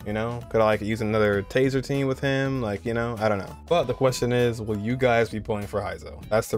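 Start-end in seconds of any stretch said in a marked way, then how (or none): none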